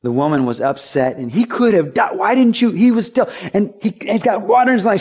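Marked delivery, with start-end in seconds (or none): 1.3-5.0 wife scolding the rescuer for waiting, higher and exasperated; flabbergasted frustrated wife. Retold or imitated. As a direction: scold him in disbelief, pitched up. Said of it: "complaining and frustrated and flabbergasted".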